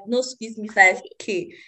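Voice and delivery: ordinary delivery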